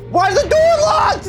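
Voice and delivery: very high, frantic yell; panicked scream. Real, not acted. Ghost hunter finding the door locked, panicked scream. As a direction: scream it as if trapped.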